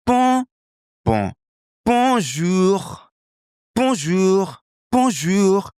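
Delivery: high-pitched, drawn-out French greeting; sing-out bonjour in a French accent; native French accent. Shown as a demonstration. Teacher drilling bonjour as Clément, native French accent. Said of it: "Can you hear the difference?".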